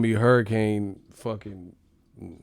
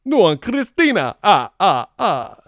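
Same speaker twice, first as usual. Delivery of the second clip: Dracula voice; 7 semitones higher, 8 dB louder than usual. Retold or imitated. The Spanish-channel count, Dracula voice. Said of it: "sound like Dracula".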